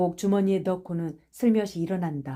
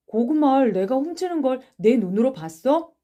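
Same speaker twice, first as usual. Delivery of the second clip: higher, raised indignant question; heated protest; defiant challenge; 5 semitones higher, 4 dB louder than usual. Acted. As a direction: dare them to prove it. Accused boy challenges accuser.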